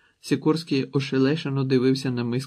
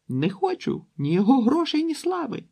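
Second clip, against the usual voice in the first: breaking into sobs; 12 semitones higher, 4 dB louder than usual. A narrator's reading. Daughter bursts out crying at the table, high tearful wail. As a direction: voice jumping much higher into tearful cries. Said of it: "заридала дочка".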